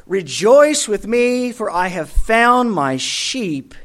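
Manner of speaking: slow, rising scripture read; joyful proclamation. Acted. Preacher ending the sermon with Luke, slow and joyful. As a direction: proclaim it slowly to close.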